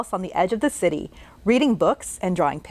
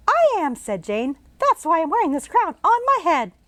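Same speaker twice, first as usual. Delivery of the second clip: put-on much higher girl's voice; bright little girl; 8 semitones higher than usual. Jane greeting the ghost she can see, as a bright girl. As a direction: chirp it like a curious girl.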